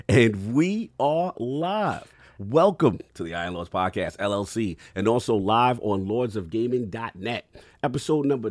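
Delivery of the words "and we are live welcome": announcer. Acted. Grand show opening.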